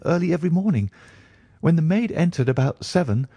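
high, hurried doctor recounting the horror; an upset doctor pouring out the story; agitated doctor. A narrator's reading.

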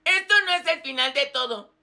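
ordinary talk, nothing marked

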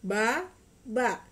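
Ba and a each said loud and slow, apart; over enunciating. Shown as a demonstration. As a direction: sound out each syllable, Ba then a. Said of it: "ikutin Mama ya".

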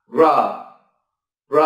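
"r" said alone as a light flap; isolated r sound. Shown as a demonstration. Teacher sounding out the initial "r" for learners. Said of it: "ta phát âm là".

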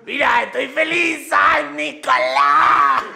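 loud, jittery glee with slow drawn-out ending; hyped-up oddball. Acted. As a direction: shout happiness as if wired to electricity. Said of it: "¿Y por qué estoy hablando así?". (eufórico, como eléctrico)

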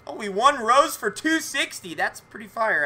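ordinary delivery

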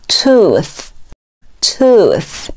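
"tooth" slowly, ending in voiceless th; model th ending. Shown as a demonstration. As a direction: draw out "tooth", tongue between teeth at the end. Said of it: "后一个音也是咬舌音". (慢读tooth，词尾咬舌发th)

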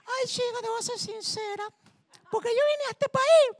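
very high, loud squawky Cuban hen. Retold or imitated. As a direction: shriek the punchline like a sassy hen. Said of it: "Dice la gallina cubana".

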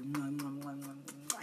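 soft kissing sounds; blown kisses. Real, not acted. Preacher blowing kisses back to loving viewers. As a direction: blow a run of air kisses.

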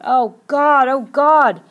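agonized high, loud cry; anguished full-voiced shout. A narrator's reading. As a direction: wail it out in desperate indecision. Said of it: "Ralph cried out".

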